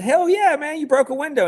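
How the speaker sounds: big, high, loud cheer; retold cheer. Boss cheering his new hire over the phone, high and loud. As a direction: cheer him on like it's good news.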